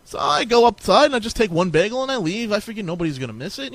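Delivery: ordinary delivery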